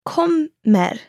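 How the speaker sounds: "Kommer" with the first syllable hit high, then flattening out; teacher modelling the stress of "Kommer" for learners. Shown as a demonstration. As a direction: punch KOM, let -mer flatten out. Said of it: "Just the stress on the first syllable".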